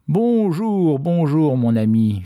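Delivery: high drawn-out greeting, falling gradually; friendly dragon. A narrator's reading. Freed dragon greets the fisherman, in a high, falling voice.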